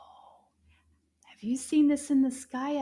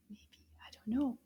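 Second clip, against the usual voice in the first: quick whispered aside; 6 dB quieter, much faster than usual. Acted.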